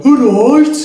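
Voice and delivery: high, loud Brummie greeting; Brummie accent. Shown as a demonstration. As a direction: say it like a Birmingham local. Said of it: "Brummies, and they will go".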